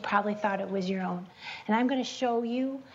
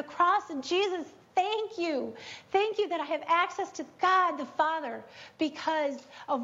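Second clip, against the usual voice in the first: fervent prayer; 6 semitones higher than usual. Retold or imitated. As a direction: pray it aloud, rapt and lifted. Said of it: "'Cause I will be like this".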